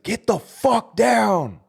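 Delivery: high, loud, drawn-out shout; slow, forceful re-enacted bellow; furious commanding yell. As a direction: stretch each word into a yell. Re-enacts cursing order at inmates.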